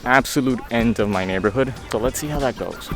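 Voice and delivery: ordinary delivery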